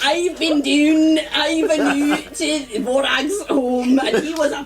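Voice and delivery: high, slow mock Scottish voice as Ren, laughing through it; bad Scottish accent. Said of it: "hard to do a bad Scottish accent".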